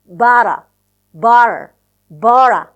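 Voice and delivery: "bara" said loud and clipped, the t turned into a flap; fused but a. Shown as a demonstration. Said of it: "but a becomes".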